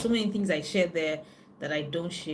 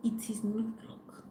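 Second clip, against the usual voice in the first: whispered aside; 3 semitones higher, 7 dB quieter than usual. Real, not acted. Telling ladies watching it is not luck, whispered.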